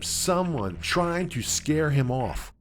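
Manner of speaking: ordinary delivery, nothing marked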